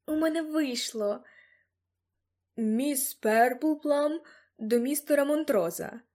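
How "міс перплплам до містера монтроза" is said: slow, drawn-out formal announcement; guard announcing. A narrator's reading. Guard presents a visitor to his employer, announcing. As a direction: announce the visitor slowly through the door.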